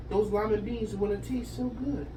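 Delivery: plain reading voice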